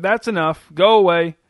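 loud, firm shouted order; shouting. A narrator's reading. Mother ordering the cackling duck to stop, shouting. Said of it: "she shouted".

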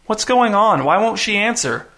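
high, anxious questions; frightened girl. A narrator's reading. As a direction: plead in fear.

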